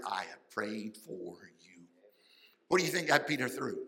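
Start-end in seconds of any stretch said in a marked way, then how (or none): 0.0-1.9 softer and lower, slow and tender; hushed, slow quoting of Jesus. Acted. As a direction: lay it down gently like a promise.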